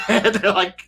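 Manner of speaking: ordinary talk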